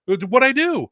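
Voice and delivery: high, baffled protest; startled. Retold or imitated. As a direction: caught off guard, confused. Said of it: "I was like".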